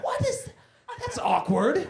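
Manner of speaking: startled adult viewer. Retold or imitated.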